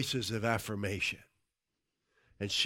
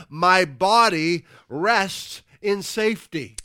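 loud, higher-pitched declaration to the congregation; preaching; 6 semitones higher, 10 dB louder than usual. Real, not acted. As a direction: proclaim it forcefully, stretching the key phrases. Preaches at full force.

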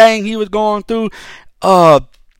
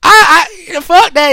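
high-pitched, heated outrage; fired-up ranting against the justice system; outraged rant; 12 semitones higher than usual. Real, not acted. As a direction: vent your disgust at the case, voice up high. Ranting furiously about the case.

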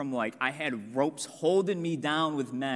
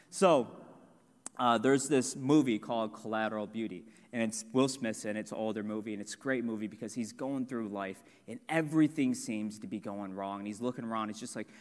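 ordinary talk

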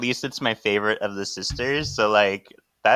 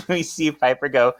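normal talking voice

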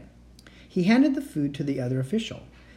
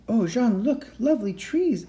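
put-on excited girl; 8 semitones higher than usual. Annie points out the window.

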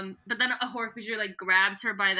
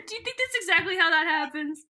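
normal talking voice

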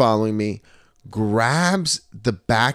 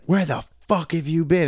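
imitated indignant demand, raised pitch; mad girlfriend; 7 semitones higher than usual. Angry girlfriend confronting him at the bar, imitated higher. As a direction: mimic her accusing question, higher but not shouted. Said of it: "So now she's all mad".

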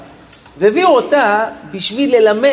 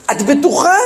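higher-pitched, pressing retold question; incredulous repeat question; 5 semitones higher than usual. Parent turns back to the teacher after the uproar, incredulous question. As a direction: imitate disbelief, pitch rising sharply.

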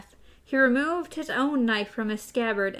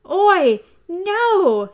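high loud scream; 8 semitones higher, 9 dB louder than usual. A narrator's reading. Jake screaming at Oi, high and loud. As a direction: scream to stop him. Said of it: "he screamed".

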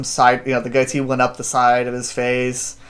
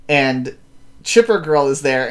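ordinary talk, nothing marked

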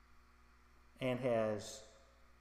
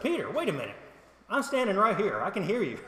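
raised, louder mock offence; mock indignant protest; 6 semitones higher, 4 dB louder than usual. Fellow disciple objecting to Peter's boast, mildly mock indignant. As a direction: protest as the slighted bystander.

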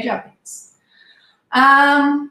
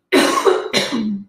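sudden cough from choking; 3 semitones lower than usual. Real, not acted. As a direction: cough as something goes down wrong. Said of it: "peraí que eu engasguei".

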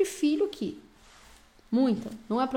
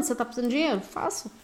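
ordinary talk

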